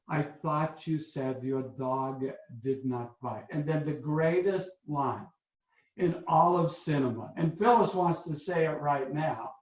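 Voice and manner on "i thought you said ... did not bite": slow, low, subdued French-accent impression; amateur French accent, dropped low and quiet; bad French accent. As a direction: deliver it slowly and softly in a poor French accent. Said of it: "in this horrible French accent".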